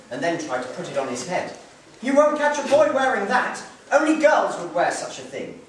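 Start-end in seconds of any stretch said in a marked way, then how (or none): 2.0-5.6 loud, high mocking tease; teasing boy. Hansel mocking the garland his sister made, teasing. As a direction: scoff at the garland. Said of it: "He teased".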